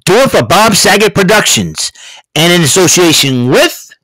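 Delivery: lightly voiced announcer closing the episode credits; mock announcer. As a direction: play the show's own announcer reading the outro credits. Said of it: "Mister Announcer, take us home".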